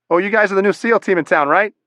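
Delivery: lightly voiced Boston ranger greeting the SEALs; Boston accent. Young ranger in a towel greets the new SEAL team, light Boston accent. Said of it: "said in a thick Boston accent".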